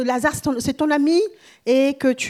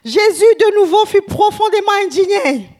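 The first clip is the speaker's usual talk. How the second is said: louder and higher, stirred up; dramatic loud scripture reading; loud indignant reading; 7 semitones higher, 6 dB louder than usual. Acted. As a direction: proclaim Jesus' indignation with force.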